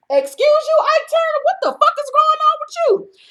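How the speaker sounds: high, mocking falsetto comeback; high sassy retort to a rude guest; sassy falsetto clapback. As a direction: shriek it at him, scandalized. Host sasses Malik in quote.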